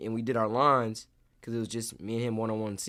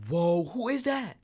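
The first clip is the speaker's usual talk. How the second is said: gasp, then high drawn-out amazement; slow, high-pitched awe; starstruck surprise; 11 semitones higher, 3 dB louder, slower than usual. Retold or imitated. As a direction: relive spotting a star. Relives spotting The Rock.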